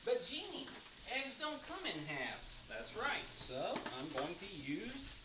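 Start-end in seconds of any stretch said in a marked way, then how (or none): 0.1-2.6 high mock objector voice. Voicing an imagined objector.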